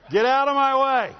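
high, loud pushy shove-aside shout; hammed-up swagger; mock bossy shout. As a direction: bark it like a proud show-off. Mocking proud believer.

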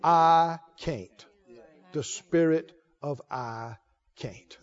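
low, soft, grave pulpit voice; hushed preaching. Real, not acted. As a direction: drop right down and let each phrase land.

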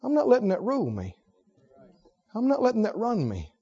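soft and firm, stress on not; not pressed gently; quiet resolve. Acted. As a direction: murmur a personal vow.

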